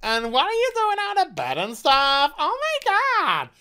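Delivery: very high, gushing airhead voice; mocking Mary as a squealing bubblehead; valley girl parody. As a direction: gush like a ditzy sorority girl. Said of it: "Mary's just like".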